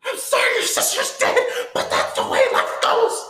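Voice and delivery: very high, loud, hammed-up mother's rant; going hard. Movie mother blows up at her son over sister's death, acted furious scream. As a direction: scream it like the movie's raging mom. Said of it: "she was going hard".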